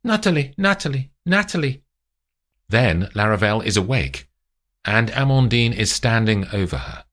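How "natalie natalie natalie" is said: high, repeated calling; calling a name. Acted. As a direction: wake the sleeper by name. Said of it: "The voice gets louder as it repeats".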